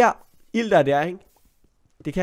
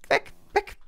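high, frantic shooing; panicky; 4 dB quieter than usual. Real, not acted. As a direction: shoo it away in a high panic. Fleeing the fire. (høj panisk stemme)

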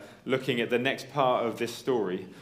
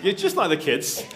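laughing aside; 6 semitones higher, 5 dB louder, faster than usual. Real, not acted. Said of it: "I'm reading ahead".

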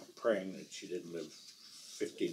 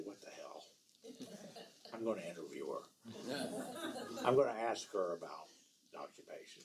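inner thought; 7 dB quieter, faster than usual. Retold or imitated.

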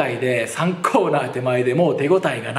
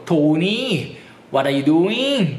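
slow, drawn-out Irish lilt; thick Irish accent; much slower than usual. Retold or imitated. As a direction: mimic a very heavily Irish-accented girl, stretching the words. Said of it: "むちゃくちゃなまってて". (むちゃくちゃきついアイルランド訛りの真似)

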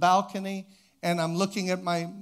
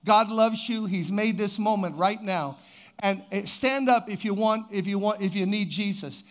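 retold altar call; 3 semitones higher, 5 dB louder than usual. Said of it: "he gets up and he says".

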